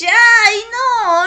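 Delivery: playful dismay; dismayed cry. Real, not acted. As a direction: cry out as the shot misses. Misses the target again.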